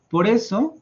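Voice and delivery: usual speaking voice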